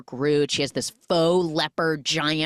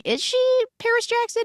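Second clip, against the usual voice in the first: high-pitched mock double take; incredulous question; 3 dB louder than usual. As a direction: ask it high and disbelieving, retelling your own doubt. Retold surprised self-question.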